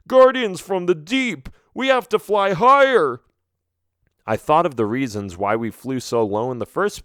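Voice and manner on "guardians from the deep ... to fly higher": shouting. Acted. Bat shouting warning.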